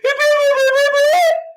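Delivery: high, sustained fake bawl; hammed-up, wordless boo-hoo wail; mock crying wail. Speaker mocking people who don't fight back tears, exaggerated fake wail. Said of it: "don't get out there and be like".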